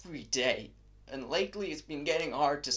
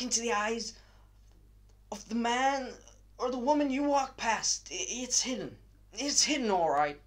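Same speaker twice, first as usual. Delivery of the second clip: agitated, raised voice, loud and high; manic rant; 6 semitones higher, 4 dB louder than usual. Acted. A madman telling the listener everyone is mad, manic rant. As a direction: rant it out like someone coming unhinged. Unhinged monologue, ranting at listener.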